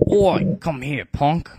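gruff, loud cockney-ish bark; a scrappy first go at a tough accent; first-take rough British. Acted. Bartray calling over Canius, loud British tough-guy voice. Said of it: "I'm gonna redo that".

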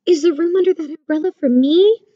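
high little mole voice; small voice. A narrator's reading. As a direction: pipe up as a small, hopeful mole. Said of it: "came Mole's small voice".

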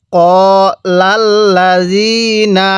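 ক্বালাল্লাযীনা very slow, long vowels held; teacher's measured tajweed model of ক্বালাল্লাযীনা; elongated Quranic recitation. Shown as a demonstration. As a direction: stretch the "zee" in ক্বালাল্লাযীনা. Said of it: "জালের মধ্যে যে এক আলিফ টান হবে".

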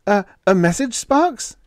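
high, loud, frightened stammer; quavering. A narrator's reading. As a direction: quaver with fear. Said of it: "he quavered".

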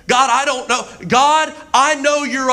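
high, pleading cry to God; impassioned acted-out prayer; desperate prayer. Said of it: "say one of my prayers".

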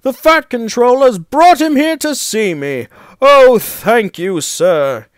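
high, loud delighted exclaiming; overjoyed. A narrator's reading. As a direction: gush with gratitude. Engine overjoyed at surprise visitor.

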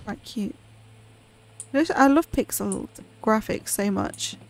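ordinary delivery

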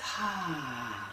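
soft, long exhaling sigh; release sigh. Shown as a demonstration. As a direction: let the breath go with a soft sigh. Guided breathing, releasing breath.

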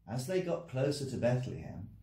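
plain reading voice

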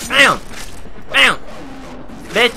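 real combat yells; battle shouts. Real, not acted. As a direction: shout each hit. Fighting enemies.

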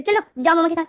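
lording it over her in front of his mother; bossy order. Retold or imitated. London bossing Wing before mother.

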